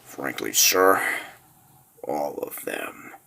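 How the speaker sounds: low and soft, fading away; grave, hushed warning. Acted. Officer admitting to his lord the danger, low and hushed. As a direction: let it sink to a slow, ominous murmur. Aide warns the ruler grimly.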